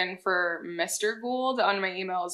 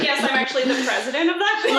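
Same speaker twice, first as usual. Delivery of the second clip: mock boast; 3 semitones higher, faster than usual.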